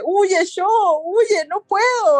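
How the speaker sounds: high, slow strained warning; possessed sister warning Sho; Kyoko straining. Acted.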